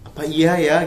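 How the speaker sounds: can this really be true?; retold surprise at the pregnancy test; disbelieving surprise. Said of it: "gua kayak".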